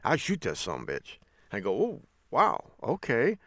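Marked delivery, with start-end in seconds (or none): 0.0-1.2 low-pitched imitation of a local; deadpan, no second thoughts; unhesitating guy. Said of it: "with no hesitation whatsoever, said". 1.8-3.4 high-pitched retold surprise; mildly stunned, higher voice; taken aback. Teacher taken aback by student's answer.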